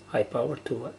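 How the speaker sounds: ordinary delivery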